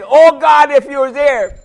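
high, loud pleading cry; desperate cry to God. A narrator's reading. Lost man on the mountain begging God, high pleading cry. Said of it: "And he said".

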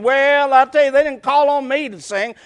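a loud grumbling member; lightly voiced grudge-holder; mock church grumbler. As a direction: whine about being slighted. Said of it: "get the attitude".

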